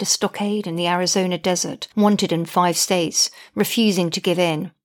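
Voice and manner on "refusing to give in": ordinary delivery, nothing marked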